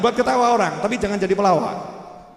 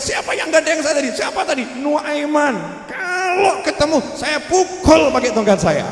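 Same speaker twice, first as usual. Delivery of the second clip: angry threat; 6 semitones higher than usual. Retold or imitated. Embarrassed blind man threatens.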